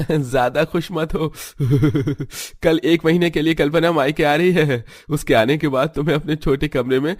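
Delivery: a laughing husband mocking his wife's short-lived joy; teasing laugh. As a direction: gloat at her with chuckles. Husband teases wife about room.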